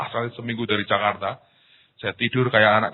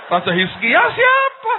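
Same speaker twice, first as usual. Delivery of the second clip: loud, very high, drawn-out question; mock bewildered outcry; 9 dB louder, slower than usual. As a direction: cry out the question in exaggerated confusion. Mengulang kebingungan dengan lebih keras. (seruan bingung yang keras dan melengking)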